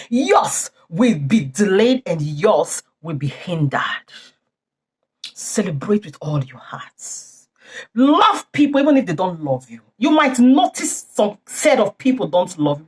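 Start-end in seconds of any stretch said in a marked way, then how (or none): 5.3-7.5 earnest whisper. Acted. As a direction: whisper it, slow and soft. Preacher whispers his plea.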